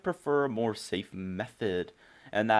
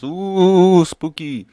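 spooky voice; 7 semitones higher, 15 dB louder, much slower than usual. Pretend. Mocking a haunted house.

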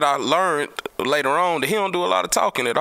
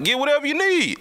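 much higher mock voice for Big Mel; imitating Big Mel; 13 semitones higher than usual. Big Mel telling him to take the camera, a much higher mock voice. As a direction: toss it off high as the generous Big Mel. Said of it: "He like".

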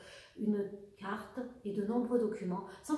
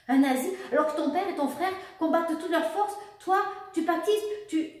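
higher, louder outraged cry; outraged mother; 8 semitones higher, 8 dB louder than usual. Acted. Mother learns the lover is a German officer, outraged. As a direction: explode in disbelief.

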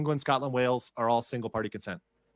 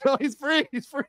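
exasperated retort; 13 semitones higher, 6 dB louder than usual. Retold or imitated. Retorting to chat, high.

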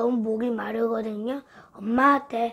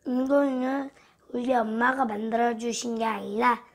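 normal talking voice

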